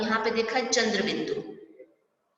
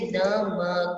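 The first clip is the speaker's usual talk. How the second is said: slow spelled-out word; much slower than usual. Shown as a demonstration. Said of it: "spelling out a word".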